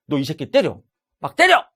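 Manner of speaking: loud egging-on that jumps high; acted urging shout. Counselor urging client to hit the imagined bully, mock shouted command. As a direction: goad them, bursting into a shout. Acting out empty-chair exercise.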